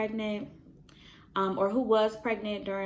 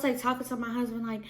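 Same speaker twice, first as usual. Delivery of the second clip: usual speaking voice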